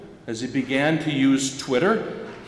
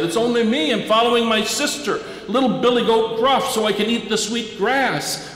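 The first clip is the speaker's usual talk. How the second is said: put-on higher, eager young goat voice; middle goat voice; 8 semitones higher than usual. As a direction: pipe up like a small goat hurrying along. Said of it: "sized billy goat Gruff said".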